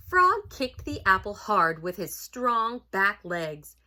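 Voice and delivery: loud, dramatic storybook reading; story narration. A narrator's reading. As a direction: build suspense for children, punching the action.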